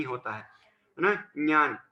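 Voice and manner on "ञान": ज्ञान said as nyan; correct palatal-nasal pronunciation. Shown as a demonstration. Teacher modelling for pupils, ज्ञान as nyan. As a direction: begin ज्ञान on ny, no g. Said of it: "ज्ञान होता है इसका सही उच्चारण".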